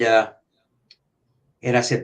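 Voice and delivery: ordinary talk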